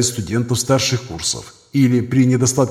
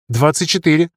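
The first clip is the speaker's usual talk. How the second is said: higher-pitched junior doctor; young surgeon; 5 semitones higher than usual. Acted. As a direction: answer in a raised, youthful pitch. Young surgeon gives his age. (молодой хирург, высоким голосом)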